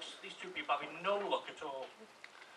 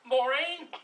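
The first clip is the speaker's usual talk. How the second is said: high, loud call; 7 semitones higher, 8 dB louder than usual. Acted. Husband shouting through to the kitchen, acted calling out. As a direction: call through to the next room. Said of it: "So he shouts through the kitchen".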